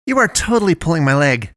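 high-pitched exasperation; exasperated. A narrator's reading.

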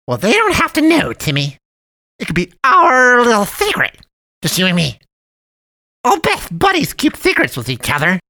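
overly sweet high cat voice, scheming; sly cat man. A narrator's reading. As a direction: wheedle the boy into a secret. Cat man urges secrecy.

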